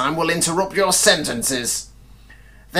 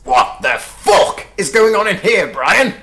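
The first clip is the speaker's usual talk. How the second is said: higher, louder outraged yell; furious; 6 semitones higher, 5 dB louder than usual. Acted. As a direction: bellow at what you see. Head bursts in outraged.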